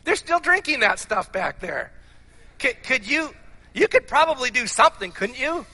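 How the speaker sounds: a hint of an eager disciple nudging for a favor; hopeful coaxing. Philip reminding Jesus of the wedding wine, lightly acted hopeful coaxing.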